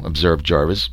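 ordinary delivery, nothing marked

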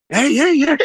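startled high hey, hey, hey; surprised. Retold or imitated. Coach startled by a player crying over shoes, surprised high calls. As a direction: caught off guard, reaching out to her. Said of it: "'cause we were surprised".

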